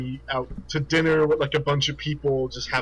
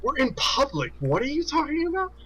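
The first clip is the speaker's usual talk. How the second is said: embarrassed disbelief; 10 semitones higher, faster than usual. Retold or imitated. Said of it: "I'm like".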